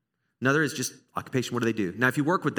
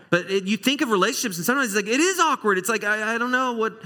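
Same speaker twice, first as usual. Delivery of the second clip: high awkward squeak; 9 semitones higher, 3 dB louder, faster than usual. Pretend. Acting out awkwardness.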